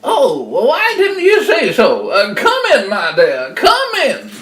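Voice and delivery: high, loud fake-granny falsetto; wolf posing as grandma. Wolf luring the child inside, mock-grandma and gushing. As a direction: gush a welcome in a put-on old-lady voice. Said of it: "You don't half sound funny".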